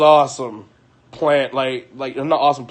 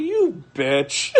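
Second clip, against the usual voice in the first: half-laughing mock jab; laughing insult; 12 semitones higher than usual. To the boyfriend who drove off, laughing.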